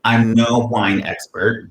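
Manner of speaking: normal talking voice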